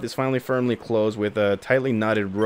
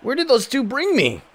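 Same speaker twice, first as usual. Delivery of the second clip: put-on lost, dazed rescued girl; confused girl; 9 semitones higher than usual. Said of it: "he's like".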